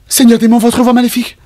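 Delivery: high, startled awe; awed gasp. Acted. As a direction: gasp in amazement. NPC gasps at voice. (stupeur émerveillée)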